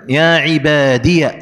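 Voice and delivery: "عبادي" slowly ending in -iya; ya with fatha. Shown as a demonstration. Teacher showing the third form, "عبادي" slowly said ibaadiya. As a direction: open the last ya of "عبادي" into -ya. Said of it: "إثبات الياء مفتوحة".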